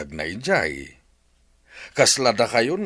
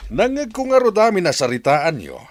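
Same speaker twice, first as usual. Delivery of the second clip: much higher, sharp accusing cry; angry outburst; 11 semitones higher than usual. Acted. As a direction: confront them, voice leaping in anger. Husband confronts wife and son. (makapungtot a panagibaga)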